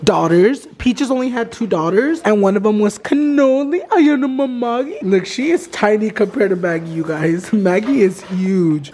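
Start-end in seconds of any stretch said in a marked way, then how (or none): none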